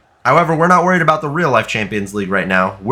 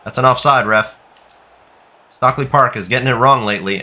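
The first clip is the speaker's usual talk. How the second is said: usual speaking voice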